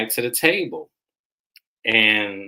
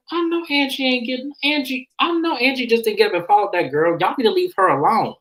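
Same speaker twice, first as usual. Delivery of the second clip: high-pitched indignant mimicry; retold annoyance, pitched way up; acted pissed-off; 11 semitones higher than usual. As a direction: play a woman outraged at her friend's betrayal. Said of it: "But Mary is pissed".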